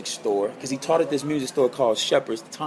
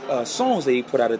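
normal talking voice

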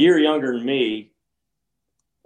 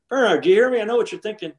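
much higher, insistent needling; retold insistent call; 9 semitones higher, faster than usual. Golfer calling the partner who ignored him, insistent and high. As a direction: call for his attention, pitched up high.

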